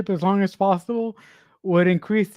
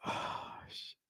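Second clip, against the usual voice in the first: whisper; 23 dB quieter than usual. Real, not acted. Shocked whisper at film.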